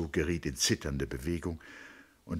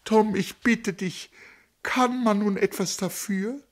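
much higher imploring to Tom; imploring repeat; 6 dB louder than usual. Acted. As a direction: repeat "kann man nun etwas dafür?" hitting "kann", begging. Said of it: "wiederholte sie".

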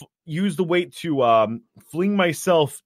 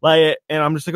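ordinary talk, nothing marked

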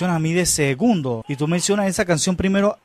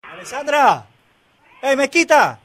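calling out; 9 semitones higher, slower than usual. Real, not acted. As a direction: shout her name to fetch her. Calling colleague across the room.

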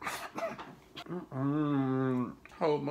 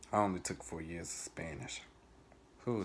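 ordinary talk

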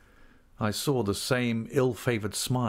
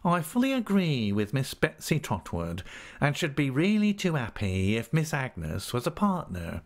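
ingratiating clerk, higher pitch; humble Uriah Heep; 5 semitones higher than usual. Acted. Clerk humbly agrees with the aunt, ingratiating. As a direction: agree with oily humility, pitched up.

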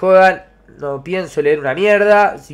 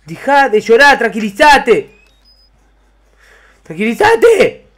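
higher, louder irritated commands; exasperated shouting; 6 semitones higher, 6 dB louder than usual. Real, not acted. Gamer yelling at a weeping character to calm down. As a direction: snap at the crying one to calm down.

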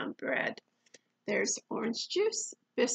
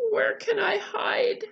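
panicky, higher and louder; frightened cry; 7 semitones higher, 7 dB louder than usual. Acted. Eddie looking for somewhere to hide, frightened cry. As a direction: cry out, desperate for cover. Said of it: "cried Eddie".

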